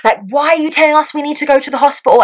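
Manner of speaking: high-pitched indignant protest; arguing. Retold or imitated. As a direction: argue back, acting out an outraged partner. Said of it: "arguing with them".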